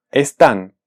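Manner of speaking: están with tán as the strong syllable; es-TÁN, weight on the second syllable; stress on last syllable. Shown as a demonstration. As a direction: lean on the final syllable of están. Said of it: "su sílaba tónica es la última".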